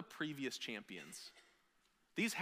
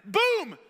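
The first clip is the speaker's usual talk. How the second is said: loud boom sound; 14 dB louder than usual. Retold or imitated. Table-kicking sound effect.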